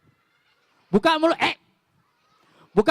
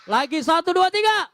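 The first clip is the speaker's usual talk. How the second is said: loud count; 7 semitones higher, 3 dB louder than usual. Real, not acted. Leading the children's shout for help, loud count. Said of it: "teriak tolong".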